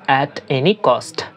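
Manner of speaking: drawn-out model phrase; teacher pronouncing slowly; slow demo. Shown as a demonstration. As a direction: say it slowly for learners. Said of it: "বলার ক্ষেত্রে বলবো".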